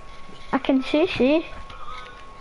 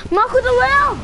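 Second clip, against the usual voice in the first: high, loud exclamation; excited shout; 8 semitones higher, 3 dB louder than usual. Real, not acted. Exclaiming in game.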